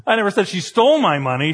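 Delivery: "stole" punched as the disputed word; stress on STOLE. Shown as a demonstration. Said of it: "depending on what word you emphasize".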